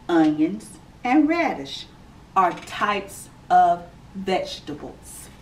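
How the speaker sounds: clear, measured read-aloud voice; gentle storybook reading for kids; reading to children. Acted. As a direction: read it out like story time for little ones. Reads picture book to children.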